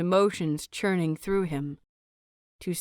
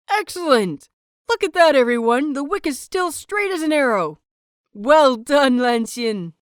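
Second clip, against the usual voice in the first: higher and louder, fast triumphant cheer; excited loud praise; 5 semitones higher, 6 dB louder than usual. Acted. Wei Wuxian showing off Lan Xin's success, loud excited praise. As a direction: call out to the whole class in delight.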